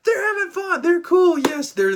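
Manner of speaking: loud, high fanboy squeal; inner kid squealing. Retold or imitated. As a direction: gush like a thrilled little kid. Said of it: "the little kid inside of me in my brain was going".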